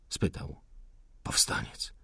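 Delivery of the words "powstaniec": whispered by a wounded man; faint wounded whisper; weak whisper. Acted. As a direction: barely breathe the word out. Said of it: "rzekł odrowąż".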